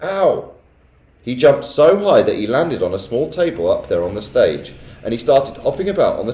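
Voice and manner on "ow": yelp. A narrator's reading. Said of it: "and yelled".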